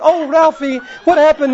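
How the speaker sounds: high-pitched mock wail; imitated parental lament. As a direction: wail it like distraught parents in a comic retelling. Parents lament over their blinded son.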